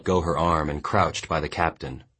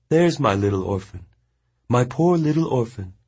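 higher, tender woman's voice; tender cooing; 7 semitones higher, 3 dB louder than usual. A narrator's reading. Mongnan tenderly rocking the boy like a child. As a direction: coo to him like a child.

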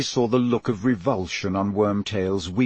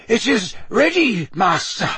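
high, strained servant voice; anxious servant; 10 semitones higher, 4 dB louder than usual. A narrator's reading. Wormtail to his master at the cauldron, high servile voice. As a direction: report to the master in a high-pitched voice.

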